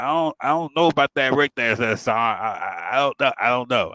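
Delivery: halting, repeated false starts; put-on stutter. Trainee dodging the instructor's order, faking a stutter. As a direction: stumble over the words on purpose. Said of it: "I start stuttering".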